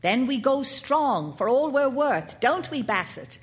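put-on a keen little boy pleading with his uncle; boy Paul. A narrator's reading. Narrator voices the boy Paul.

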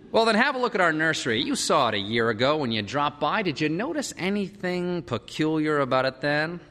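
brisk, lifted opening easing into a question; concerned father. A narrator's reading. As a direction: consult a friend about the problem. Father consults psychologist friend.

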